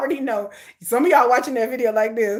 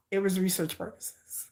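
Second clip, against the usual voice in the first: whispered excuse; a guilty whispered aside; 7 semitones lower, 11 dB quieter than usual. Real, not acted. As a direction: breathe it like a secret.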